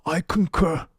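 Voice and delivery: halting, bad senator impression; Bernie Sanders. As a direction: stammer it out like an old politician. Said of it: "a bad impression of Bernie Sanders".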